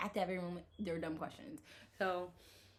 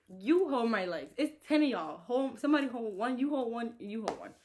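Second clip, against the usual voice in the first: louder, higher bossy demand; retold angry orders; 4 semitones higher, 6 dB louder than usual. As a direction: order the staff around in mock fury. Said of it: "I was so angry".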